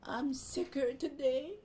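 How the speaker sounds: high, slow feeble moan; drawn-out invalid whine; whiny sick cockroach. Acted. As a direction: ham up being even sicker. Cockroach plays sick again.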